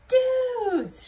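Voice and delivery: shout. Acted. Scapito shouting to his trapped friends. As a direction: shout it across to them. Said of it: "shouted Scapito".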